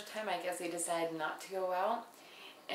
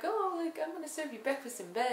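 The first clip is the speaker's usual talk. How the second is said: put-on high, sugary girlish voice; high sing-song offer; mock sweet girly; 9 semitones higher than usual. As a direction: chirp it like a cheerful prankster. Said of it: "they just thought, like".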